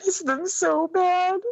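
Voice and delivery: high, slow sobbing cry; real weeping. Real, not acted. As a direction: let the voice break with grief. Grieving mother breaks down.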